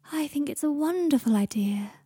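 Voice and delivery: slow, high, airy voice; dreamy Luna. A narrator's reading. Luna approves Draco's plan, dreamy and slow. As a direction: float it out dreamily and slowly.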